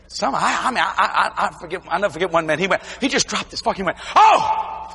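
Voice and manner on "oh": startled cry. Retold or imitated. Man startled by preaching.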